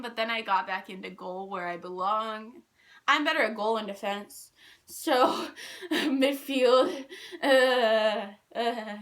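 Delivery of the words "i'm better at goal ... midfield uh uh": ordinary talk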